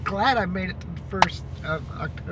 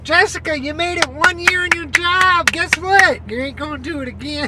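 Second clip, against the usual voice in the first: higher, louder teasing call to herself; loud mock taunt; 6 semitones higher, 9 dB louder than usual. Real, not acted. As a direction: needle herself loudly and playfully. Teasing herself about the job.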